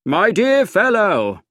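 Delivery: high, loud, drawn-out exclamation; cried out. A narrator's reading. Narrator cries out to his secretary, high and loud. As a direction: call it out grandly. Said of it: "I cried".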